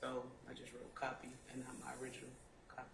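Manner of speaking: soft, mumbled testimony; barely above a murmur; quiet. Real, not acted. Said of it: "Speak up, please".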